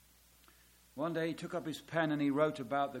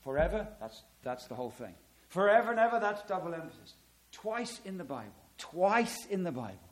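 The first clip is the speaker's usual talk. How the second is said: ordinary delivery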